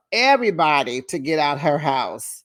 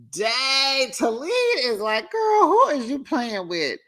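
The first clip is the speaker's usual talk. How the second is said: impressed exclaim; 8 semitones higher than usual. Retold or imitated. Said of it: "I was like".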